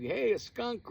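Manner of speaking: high, loud mock warning; mock alert call. Narrator suggesting what friend should have yelled, high loud mock call. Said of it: "He could have sat there and said".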